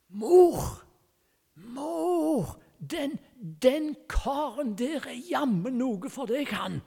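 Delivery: put-on a five-year-old blurting loudly, high and excited, meaning to whisper; child's loud would-be whisper. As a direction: tug at mum's sleeve and shout it, thinking it's a whisper. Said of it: "så kviskrer han. Altså, det vil si, han trodde at han kviskret". (høyt barnerop som skulle vært kvisking)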